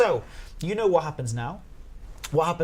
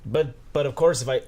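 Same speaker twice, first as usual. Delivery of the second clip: ordinary delivery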